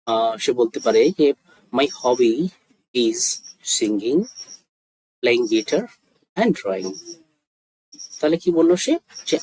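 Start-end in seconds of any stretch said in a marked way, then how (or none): 1.7-6.9 spaced out, a pause after each item; slow demonstration. Shown as a demonstration. Teacher models a student's hobbies answer, slowly with pauses. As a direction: list them unhurriedly for learners to copy. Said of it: "আস্তে আস্তে বলছি".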